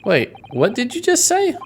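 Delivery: high, shocked question; acted double take; stunned disbelief. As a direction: voice climbing sharply in alarm. Character hears of a death.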